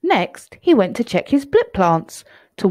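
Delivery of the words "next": usual speaking voice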